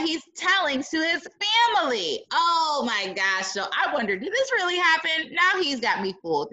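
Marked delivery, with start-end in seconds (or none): none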